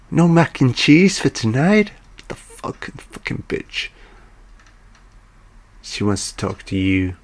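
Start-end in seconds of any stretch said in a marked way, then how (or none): 0.1-1.9 mock indignant. Acted complaint about dinner. 2.3-3.9 whispered mock curse under the breath; muttered aside, barely voiced. Muttered curse after the scene.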